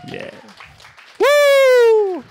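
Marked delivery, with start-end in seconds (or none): 1.2-2.2 high loud self-cheer whoop. Real, not acted. Speaker whooping to start his own applause, high and loud. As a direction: cheer yourself on with a big whoop. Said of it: "let's get some applause".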